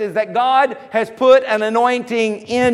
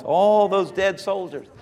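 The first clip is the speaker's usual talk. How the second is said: usual speaking voice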